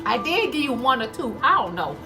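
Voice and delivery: ordinary talk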